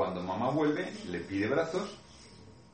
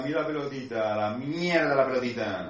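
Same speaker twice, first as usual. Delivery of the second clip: put-on sulky child; 2 semitones higher, 4 dB louder than usual. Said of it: "verán lo que pasa".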